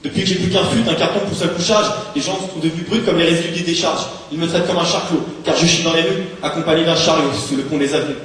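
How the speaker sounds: rising rage in rhythmic verse; angry slam outburst. Acted. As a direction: build to an angry climax, pitched high and driven. Slam poem climaxes in rage.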